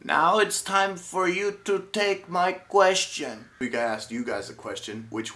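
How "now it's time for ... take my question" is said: slow, high announcer voice. Acted. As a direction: announce it like a show host. Announcer style intro.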